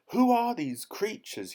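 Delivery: very high, loud incredulous question; high-pitched blustering cry; outraged bellow. A narrator's reading. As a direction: bellow it in disbelief. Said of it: "he bellowed".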